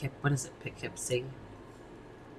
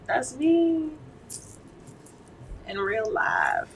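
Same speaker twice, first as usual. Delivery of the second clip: put-on high, airy Valley girl preening; much higher, chirpy Valley girl; 13 semitones higher, 5 dB louder, slower than usual. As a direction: play the ditzy California girl. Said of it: "a Valley girl".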